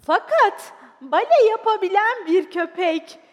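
high, loud, slow proclamation; excited shout. Acted. Spectator going on about a dancing dog, excited shout. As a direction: announce the marvel to the whole hall. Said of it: "diye devam etti ayağa kalkan seyirci".

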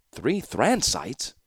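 slow, doubting "Three Thran sites?"; sceptical question. Acted. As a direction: raise an eyebrow as you ask it. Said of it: "eyebrow canted".

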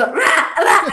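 high, loud mock barks; imitated barking. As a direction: bark like an insistent dog. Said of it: "a ladrarme otra vez".

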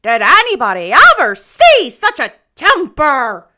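loud, high scandalized exclamation; horrified. A narrator's reading. Rachel exclaims at Anne's temper, horrified. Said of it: "exclaimed horrified Missus Rachel".

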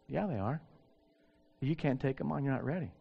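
soft, calm divine reply; voice of God. Retold or imitated. God calmly answering the preacher, soft and quiet. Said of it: "And God said".

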